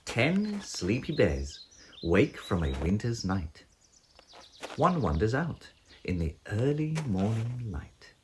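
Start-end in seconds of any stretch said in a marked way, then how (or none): none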